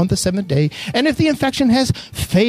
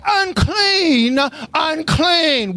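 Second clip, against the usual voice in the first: high, drawn-out warning call; lepers' cry; 7 semitones higher, much slower than usual. Acted. Preacher acting what ten lepers were supposed to say, crying out. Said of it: "these ten lepers were supposed to say".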